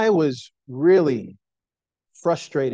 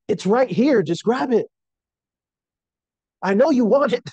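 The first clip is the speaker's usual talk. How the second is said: higher-pitched retold parent coaxing; coaxing a baby; 6 semitones higher than usual. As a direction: plead with a fussy baby, voice lifted. Coaxing crying baby with pacifier.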